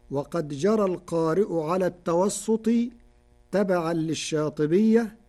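ordinary talk

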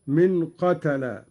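ordinary talk, nothing marked

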